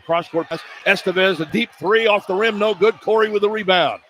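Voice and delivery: ordinary delivery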